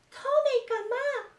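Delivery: a tiny excited puppet shouting hello; puppet character voice. Acted. Puppet greeting the children in Irish, high and squeaky. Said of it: "two new characters".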